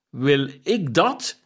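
slow, incredulous question; exclaiming. Acted. The ghost, questioned by Scrooge, exclaims slowly in disbelief. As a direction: weigh each word in disbelief. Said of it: "riep de geest uit".

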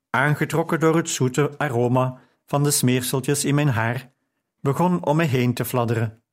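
plain reading voice

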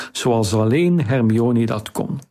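ordinary delivery

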